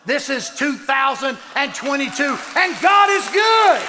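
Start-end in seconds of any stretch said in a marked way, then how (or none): 2.5-3.8 slow, loud, higher proclamation; exultant. Real, not acted. Former alcoholic testifying God is good, slow loud shout. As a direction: proclaim it with everything you have.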